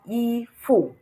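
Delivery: "ifu" with fu falling hard; high i, then drop firmly on fu; wrong fourth tone. Shown as a demonstration. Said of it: "there is no tone on the U".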